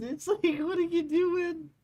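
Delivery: squealed disbelief. Retold or imitated. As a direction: squeal the question in mock horror. Repeats the question, much higher.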